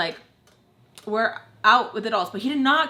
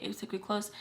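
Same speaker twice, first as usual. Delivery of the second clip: ordinary delivery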